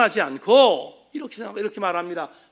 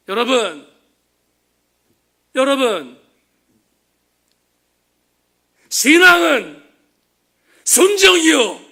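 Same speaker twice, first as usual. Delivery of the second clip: impassioned preacher's cry in Gyeongsang dialect, much louder and higher, slow; Pastor Ok's Gyeongsang shout; 6 semitones higher, 12 dB louder, slower than usual. Retold or imitated. Preacher recalling a dying pastor's plea to his flock, impassioned Gyeongsang shout. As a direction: cry it out to the congregation, dialect ringing. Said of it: "경상도 사투리로 이렇게 외쳤던".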